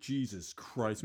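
usual speaking voice

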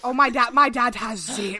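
ordinary delivery

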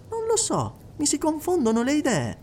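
put-on a young woman unsure what to think; Enid's voice. Enid, puzzled, softer.

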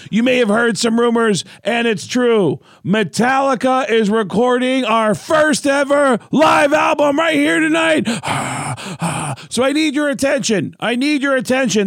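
high, loud announcer shout; proper stage yell. Retold or imitated. Comedian announcing a fake live album to the crowd, high loud shout. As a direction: yell it out to a whole stadium. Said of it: "There's a proper way to yell".

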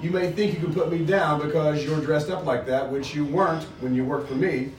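reading a novel aloud to the group; steady read-aloud with the characters lightly voiced; story reading. To the book group, reading the chapter aloud.

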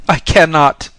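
high, loud outburst of fear; frightened cry. A narrator's reading. Pilgrim refusing the Bodhisattva's order to look, frightened cry. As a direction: cry it out as if clinging for life. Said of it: "cried the pilgrim, trembling and clinging".

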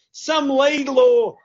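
high, loud, slow name call; announcing a caller. Real, not acted. Host announces the last caller.

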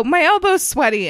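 high, eager imitation of a workout extra; mock attention-seeking call. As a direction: pipe up hoping to be noticed. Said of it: "screaming things out from the bottom".

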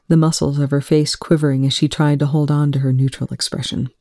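usual speaking voice

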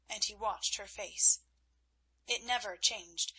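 usual speaking voice

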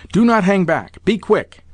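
high-pitched, impatient command; feverish urging. A narrator's reading. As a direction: hurry him along feverishly. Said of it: "was in a perfect fever".

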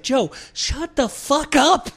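high, slow mock snarl; imitating angry Bill. Bill snapping at Joe before his set, high slow mock snarl. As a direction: bark it like a furious friend. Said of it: "And he's like".

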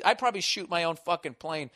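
ordinary talk

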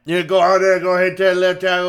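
loud, slurred Cajun coach impression; unintelligible coach. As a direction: garble the instructions like a Cajun coach. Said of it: "what the fuck did coach just say".

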